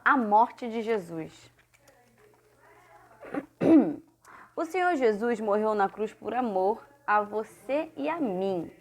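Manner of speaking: ordinary talk, nothing marked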